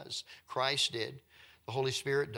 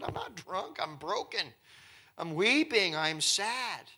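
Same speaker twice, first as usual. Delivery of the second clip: lightly acted anguished protest, higher pitched; acted broken Hannah; 6 semitones higher, 3 dB louder, slower than usual. Broken woman answering the priest who thought her drunk, mildly acted distress. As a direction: plead it in a raised voice, without real tears. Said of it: "she was so broken as she was weeping".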